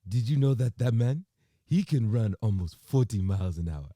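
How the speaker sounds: put-on accidental African accent, punched out loudly. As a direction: slip into an African accent while quoting. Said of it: "did that in an African accent".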